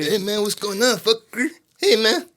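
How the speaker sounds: hammed-up Mexican accent, rising high; mock Mexican accent, building up; put-on Mexican accent. Host performs his put-on Mexican accent for friends. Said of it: "You did the accent on our podcast".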